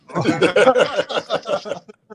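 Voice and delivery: long high chuckling laugh. Real, not acted. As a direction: laugh it out at your own joke. Laughs after his own joke.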